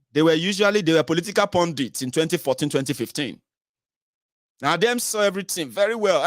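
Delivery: ordinary delivery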